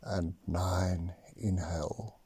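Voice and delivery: slow counting, whispered on exhales; slow breath counting. Acted. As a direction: count each breath calmly, long pauses. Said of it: "actually count your breaths now".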